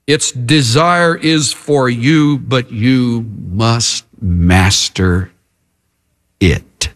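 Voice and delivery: very slow, word by word, sinking low; grave warning. Acted. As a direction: spell out a solemn warning, dropping on the last word. Preacher reads God's warning to Cain.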